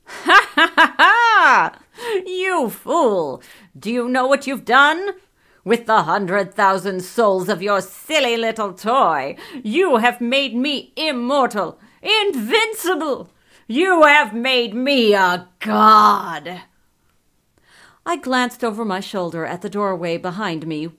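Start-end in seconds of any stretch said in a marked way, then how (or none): 0.0-1.8 loud, high triumphant evil laugh; villain's cackle. A narrator's reading. 2.3-16.6 loud, raised, megalomaniac wizard king; gloating villain. A narrator's reading. Wizard King gloats over power.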